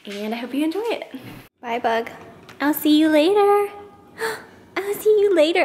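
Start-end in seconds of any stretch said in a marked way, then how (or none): 1.6-5.6 high sing-song pet voice; talking to a dog. Real, not acted.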